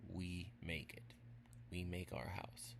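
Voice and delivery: ordinary delivery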